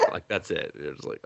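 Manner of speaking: normal talking voice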